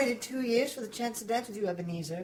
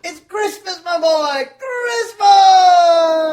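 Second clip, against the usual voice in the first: high, loud exultant shout; joyful cry; 8 semitones higher, 15 dB louder, much slower than usual. Acted. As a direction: cry out the holiday with joy. Fezziwig crying out joyfully.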